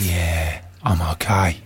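very low, husky murmur of a wooer; husky suitor. Acted. Suitor answering his date at a bar, low and husky. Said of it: "Very husky voice you've got there".

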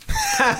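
high shrieking laugh; a sudden squealed laugh; real high laugh; burst of laughter. Real, not acted. Reacting to the dad's email.

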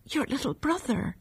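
faint, fading weak voice. A narrator's reading. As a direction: echo it weakly, barely there. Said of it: "echoed her mother's weak voice".

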